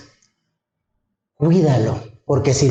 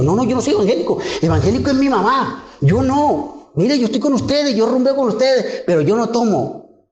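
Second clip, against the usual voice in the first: retold protest; 9 semitones higher than usual. His own pleading reply retold.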